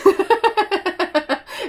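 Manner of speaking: a high, loud burst of laughter; real laugh. Real, not acted. Laughing about proving her hubby wrong, real laughter.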